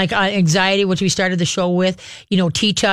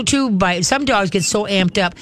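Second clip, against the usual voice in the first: ordinary delivery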